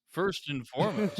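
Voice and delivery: ordinary delivery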